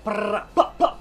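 loud high gun sound effect; shouted gunshot noise. Retold or imitated. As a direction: yell a rapid gunfire noise. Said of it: "somebody shouts at you like".

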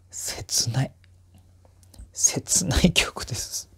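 hushed, as if moved by the song. Real, not acted. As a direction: breathe the word out in a hushed whisper. Reacting softly to a sad song.

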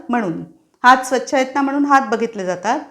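normal talking voice

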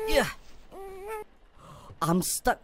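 ordinary talk

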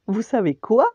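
bursting to share a secret; all excited. Acted. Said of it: "demanda Samantha tout excitée".

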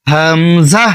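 হামজাহ short and clean, no stretch; Arabic letter with no pull. Shown as a demonstration. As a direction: say হামজাহ without drawing it out. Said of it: "এভাবে বলবেন". (হামজাহ টান ছাড়া)

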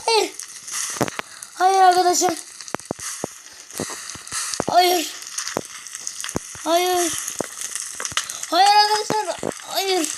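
high panicked cries of no; real fright as the game turns on him. Real, not acted. Minecraft attack, panicked repeated no.

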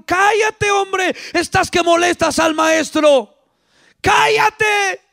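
high, sharp hushing cry; scolding shout. Acted. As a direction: silence the beggar as an annoyed disciple. Disciples hushing the blind man.

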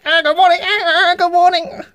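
high, loud flustered stammer; nervous greeting. Acted.

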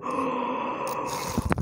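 long voiceless intake of breath; big breathy gasp of disbelief; shocked gasp. Real, not acted. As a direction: gasp hard in amazement. Overwhelmed by the rare catch.

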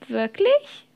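sharply rising, incredulous question; mild tearful surprise; hopeful question. A narrator's reading. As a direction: ask it with a leap upward at the end. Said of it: "und er schniefte laut".

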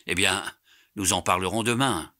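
plain reading voice

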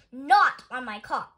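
slow, loud, emphatic scolding; exasperated scolding. A narrator's reading. Mister Rogers scolds Amelia about the egg, exasperated. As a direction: spell it out loudly and slowly. Scolding her over the car.

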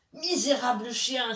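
la panthère crie, plus haut et plus fort; cri furieux. Acted. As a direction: lance l'insulte avec fureur. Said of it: "s'écria la panthère, furieuse". (la panthère furieuse crie contre Chien Bleu)

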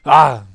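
sudden, very loud "ah!"; short loud cry of frustration; real yelp on crashing; startled yell. Real, not acted. Player crashes in the game.

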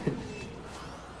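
quiet laugh after the win; soft laugh. Real, not acted. Celebrating an easy win with viewers, quiet laugh. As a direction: chuckle to himself, pleased.

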